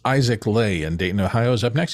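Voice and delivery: normal talking voice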